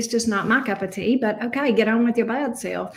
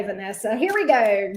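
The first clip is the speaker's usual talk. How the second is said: ordinary delivery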